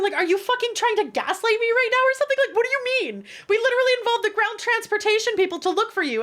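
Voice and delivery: high, indignant retold rant; mock outrage. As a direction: pile on the disbelief. Retold rant at gaslighting guide.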